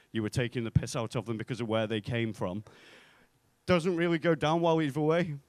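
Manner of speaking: usual speaking voice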